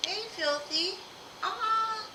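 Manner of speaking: high, delighted greeting; excited squeal. Real, not acted. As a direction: squeal a happy hello.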